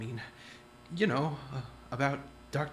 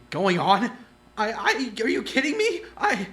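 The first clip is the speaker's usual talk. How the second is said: loud, high disbelieving outburst, much higher pitch; exasperated outburst; 7 semitones higher, 9 dB louder than usual. Acted. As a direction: blurt it out, fed up and incredulous.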